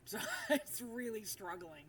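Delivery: amused laugh breaking into speech. Real, not acted. Laughing at budget graphics card.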